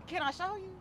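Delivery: ordinary delivery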